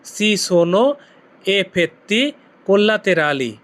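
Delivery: "effetti collaterali" stretched out very slowly; very slow model of the whole Italian phrase; very slow demo. Shown as a demonstration. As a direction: spell out "Si sono effetti collaterali" slowly for learners. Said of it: "আপনি Italian ভাষায় কি বলবেন?".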